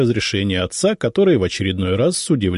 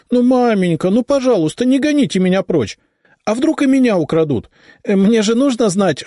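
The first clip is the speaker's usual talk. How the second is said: very high, louder playful begging; pleading girl; 14 semitones higher, 5 dB louder than usual. A narrator's reading. Daughter begging mother not to send her away, wheedling.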